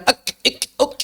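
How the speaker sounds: ak ik uk with too much air; overdone hams. Shown as a demonstration. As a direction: push excess breath after each k. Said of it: "jangan berlebihan". (hams berlebihan)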